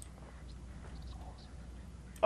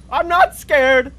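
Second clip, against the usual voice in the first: slow, high, loud protest; defiant scared shout; 7 semitones higher, 8 dB louder, much slower than usual. Real, not acted. Player denying fear after a scream, shouting. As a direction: shout it as if convincing yourself.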